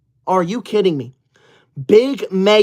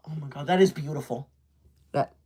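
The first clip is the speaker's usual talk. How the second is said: much softer and lower, choked up; teary and moved; 6 semitones lower, 9 dB quieter than usual. Acted. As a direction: overcome by a kind comment. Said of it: "There's tears in my eyes".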